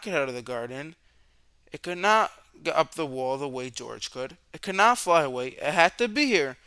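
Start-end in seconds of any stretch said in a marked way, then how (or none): none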